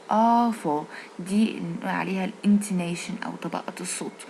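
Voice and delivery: ordinary delivery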